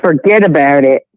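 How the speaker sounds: light Canadian accent on forget about it; understated Canadian accent. Shown as a demonstration. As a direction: say it like a Canadian on request. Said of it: "Let's hear your accent".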